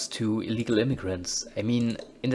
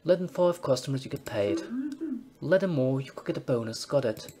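put-on higher, chummy British publican accent; British pub landlord accent; 4 semitones higher than usual. Dave Pleasure briefing his new bouncer in British accent. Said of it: "I should stop doing the accents".

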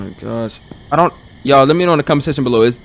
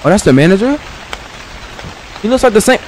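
ordinary delivery

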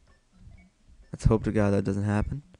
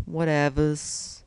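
higher, drawn-out "Whatevers"; playful put-on repeat; 6 semitones higher, slower than usual. Gamer shrugging off his accent slip, playful high drawl.

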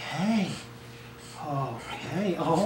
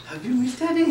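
doting dog-talk; 6 semitones higher, faster than usual. Real, not acted. Dad greeting excited dog after work.

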